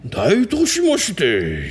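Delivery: very slow, drawn-out mock-samurai line; samurai man. Host opens the samurai skit, slow and ceremonious put-on voice. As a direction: stretch it out like a hammy samurai. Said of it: "can I be the samurai man having sex with you".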